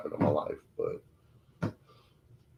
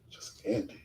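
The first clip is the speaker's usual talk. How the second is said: hushed, insistent whisper; voice in his head; 13 dB quieter than usual. Retold or imitated. Inner voice urging him to give up, whispered. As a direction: whisper it like an intrusive thought. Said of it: "I hear some voices in my head".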